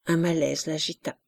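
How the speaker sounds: usual speaking voice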